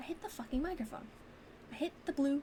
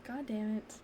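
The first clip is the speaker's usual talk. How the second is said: ordinary talk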